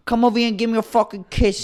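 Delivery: loud, high, brash Brooklyn wife; coarse Brooklyn accent. Pretend. Brooklyn wife yelling at husband in coarse Brooklyn accent. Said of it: "coarse, dirty Brooklyn accent".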